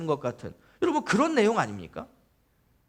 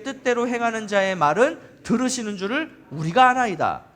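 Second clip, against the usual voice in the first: raised, declamatory Bible reading; emphatic scripture reading; 3 semitones higher, 4 dB louder than usual. Acted. Preacher reading with the congregation, emphatic declamation. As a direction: read the verses out with conviction.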